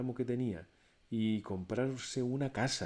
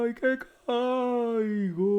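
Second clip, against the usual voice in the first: high, very slow drawn-out moaning; ghostly wail; 8 dB louder, much slower than usual. A narrator's reading. Ghostly voice in the night wails that it's falling. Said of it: "la voz siguió diciendo".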